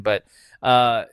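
usual speaking voice

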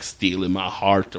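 slow, low mock sweet-talk; lightly put-on Southern flirt, drawn out; cheesy Southern charmer. Cop teasing the nurse he's arresting, cheesy Southern charm. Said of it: "Fucking terrible Southern shit".

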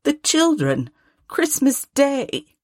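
high, slowing plea from a husband; mild, high-pitched appeal; gently chiding Bob. A narrator's reading. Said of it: "said Bob".

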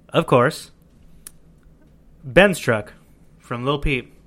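ordinary talk

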